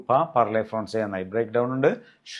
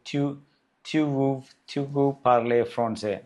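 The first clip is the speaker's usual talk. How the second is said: very slow, every word spaced; slow French model; 2 semitones higher, much slower than usual. Shown as a demonstration. Teacher showing students the informal question, slowly. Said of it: "ഇൻഫോർമലായിട്ട്".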